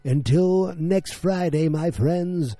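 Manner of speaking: high, loud announcer farewell, last word drawn out; hammed-up, swelling podcast outro; grand theatrical sign-off. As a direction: proclaim the show's closing catchphrase like a showman.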